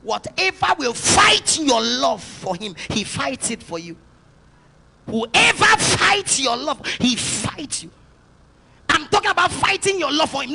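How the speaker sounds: preaching at full force. Acted. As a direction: drive it home with a shout. Preacher builds to shouting.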